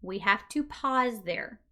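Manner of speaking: usual speaking voice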